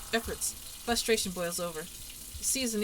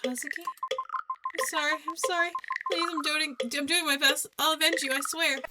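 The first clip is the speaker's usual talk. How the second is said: much higher, strained, rising louder; frantic pleading; 8 semitones higher, 4 dB louder than usual. Acted. Pleading with Kazuki's ghost, frantic and high. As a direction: beg in panic, voice climbing.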